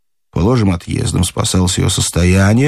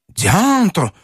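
plain reading voice

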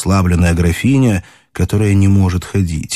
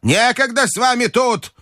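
shouting grandmother. A narrator's reading. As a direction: cry out impatiently in an old lady's high voice. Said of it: "кричала бабушка".

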